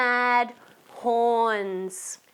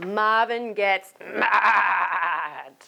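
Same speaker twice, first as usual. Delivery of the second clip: mad shout; 2 semitones lower, 3 dB louder, much slower than usual. Shown as a demonstration. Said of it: "reading title in mad voice".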